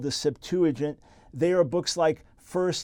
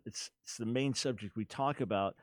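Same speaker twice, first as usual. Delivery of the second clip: normal talking voice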